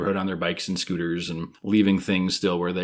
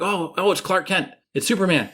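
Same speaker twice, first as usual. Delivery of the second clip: mock recognition; 10 semitones higher, 3 dB louder than usual. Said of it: "and I'm like".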